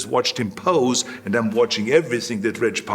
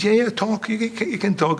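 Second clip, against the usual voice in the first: a lightly voiced Reg Park, higher and pushing; urging coach; 8 semitones higher than usual. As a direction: coax a nervous friend to speak. Reg Park urging him onstage.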